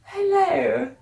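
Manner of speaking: badly faked Australian hello, coming out British; British-sounding Australian accent. Practising hellos for a friend, Australian accent gone British. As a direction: attempt Australian, land on British. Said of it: "That's British".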